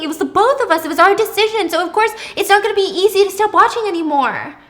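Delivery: loud, high, fast defensive rant; heated defensive argument. Acted. Pippa arguing back.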